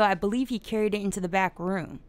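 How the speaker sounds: usual speaking voice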